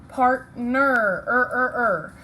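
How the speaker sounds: "er" drawn out and repeated on its own; er sound. Shown as a demonstration. Teacher sounding out the er for spelling. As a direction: sound out "partner, er, er, er" with the er stressed. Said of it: "it has an er".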